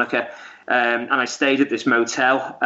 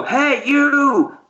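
imitated shout at a passerby; retold yell; 13 semitones higher than usual. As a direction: call out sharply to a stranger, much higher than usual. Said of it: "this guy go".

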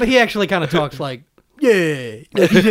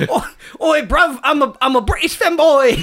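put-on broad comic British accent, pitched high; hammed-up high British lad; 6 semitones higher than usual. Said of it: "that's what [name] sounds like".

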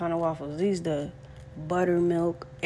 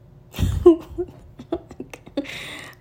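burst of laughter; 12 semitones higher, 7 dB louder than usual. Real, not acted. Laughs at his own inner pep talk.

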